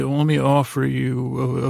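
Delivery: ordinary talk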